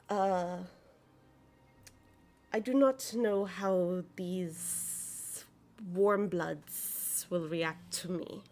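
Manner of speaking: slow, hesitant dragon-woman voice; unsure, slow and careful; Zelthara speaking. Acted. Zelthara warns the party, hesitant.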